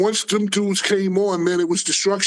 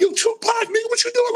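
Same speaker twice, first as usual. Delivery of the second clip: much higher mock yell at a friend; retold shout; 13 semitones higher than usual. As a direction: call out across the commotion. Calling out to [name] in a fight.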